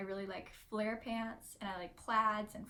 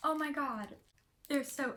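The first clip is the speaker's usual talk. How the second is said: excited; 5 semitones higher, 4 dB louder than usual. Real, not acted. Thrilled by the sunglasses.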